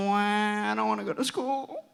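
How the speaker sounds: playfully hammed-up pouting; mock whining. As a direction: whine like a reluctant schoolkid. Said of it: "except I'm kinda bummed".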